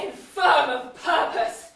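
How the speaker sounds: slow, loud scornful jab; contemptuous scorn. Acted. Wife scorning her husband's weakness, slow and loud. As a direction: shame him for his weakness.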